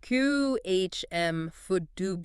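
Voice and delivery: slow "Q H M for dubu", each sound separate; accepted variant. Shown as a demonstration. Host repeating an acceptable version, slow pronunciation demo. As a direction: stretch out "Q H M for dubu". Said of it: "You'll hear".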